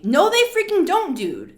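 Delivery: high, loud indignant denial, dropping at the end; pissed-off retort. Acted. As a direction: shoot down the claim, exasperated. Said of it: "Like, I'm getting pissed".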